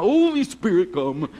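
hammed-up, loud and high "Holy Spirit" then dropping to "come"; goofy mock prayer. Preacher mocking a showy healing prayer, hammed-up and loud. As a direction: overdo "Holy Spirit" like a showy faith healer. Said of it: "And not in a goofy way".

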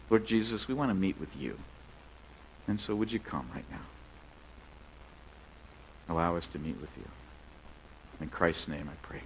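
heartfelt pastoral prayer; sincere prayer. Real, not acted. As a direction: pray it quietly from the heart. Pastor prays.

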